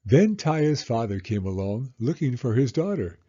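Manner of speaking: usual speaking voice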